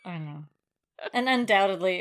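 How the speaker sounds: ordinary delivery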